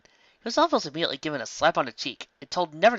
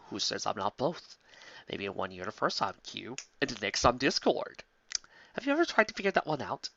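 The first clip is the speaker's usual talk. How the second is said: sly tease; 6 dB quieter than usual. Acted. Trickster teasing.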